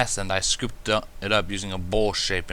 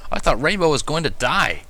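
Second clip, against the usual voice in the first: shocked warning; 6 semitones higher, 4 dB louder than usual. A narrator's reading. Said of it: "he warned, sounding actually shocked".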